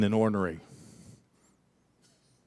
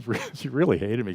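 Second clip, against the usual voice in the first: usual speaking voice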